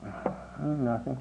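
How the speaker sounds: put-on crying boy. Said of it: "And he was crying by this time".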